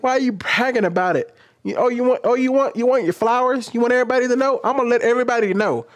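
high-pitched mocking needling; petty taunting. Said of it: "That's how petty I used to get".

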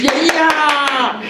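loud, high crowd whoop; imitated cheering. School kids cheer proud boy at assembly, imitated loud cheer. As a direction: cheer it out like an excited audience.

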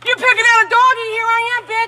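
high-pitched angry challenge; real goading anger; confrontational taunt. Real, not acted. Neighbour confronting him about the dog.

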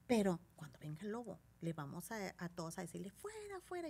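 put-on soft coaxing to the children; hushed coaxing. Animadora calmando niños. (voz baja para convencer al niño)

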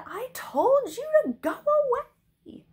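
high, loud, slow exasperated yell; shouted scolding. A narrator's reading. Princess ordering the knight away, shouting. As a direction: shout each word to drive someone off. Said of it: "Cimmerian shouted".

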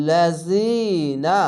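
লাজিনা very slow with the long i held; long i stretch. Shown as a demonstration. Teacher showing one-alif stretch, very slow chant. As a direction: draw out the ee in লাজিনা. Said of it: "ইয়া সাকিন এক আলিফ টেনে পড়বেন".

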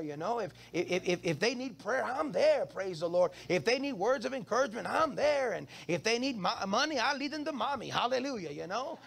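normal talking voice

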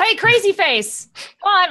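high, loud, drawn-out holler; mock taunting call. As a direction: call out cheekily to get his attention. Calling out to the attacker.

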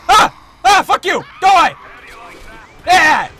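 loud, high, aggressive outbursts; combat yelling. Real, not acted. Player fights back at an enemy, aggressive taunting shouts. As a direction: yell taunts with each hit. Yelling while attacking in game.

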